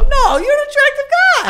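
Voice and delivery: very high, loud protest; emphatic protest. Real, not acted. Friend insisting to his friend he's attractive, emphatic high protest.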